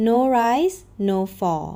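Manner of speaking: ordinary delivery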